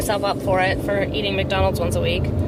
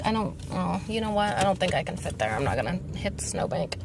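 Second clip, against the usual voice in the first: usual speaking voice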